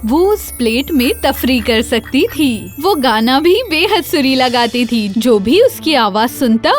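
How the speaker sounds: ordinary delivery, nothing marked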